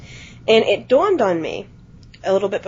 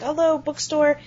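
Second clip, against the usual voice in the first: higher-pitched customer service voice; chirpy shop phone greeting; 5 semitones higher than usual. Retold or imitated. Clerk answers the bookstore phone, higher customer service voice. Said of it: "and I said".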